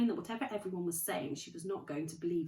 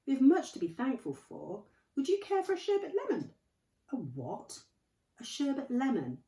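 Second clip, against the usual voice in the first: airy Dumbledore; 8 semitones higher, 6 dB louder than usual. Acted.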